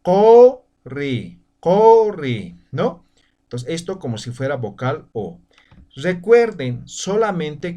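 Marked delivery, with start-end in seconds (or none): 0.0-2.6 corri said louder, the u sounding like o; pronunciation demo. Shown as a demonstration. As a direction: model corri clearly for the class. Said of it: "vamos a pronunciar".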